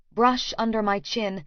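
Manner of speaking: ordinary delivery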